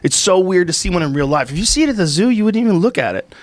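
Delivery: ordinary talk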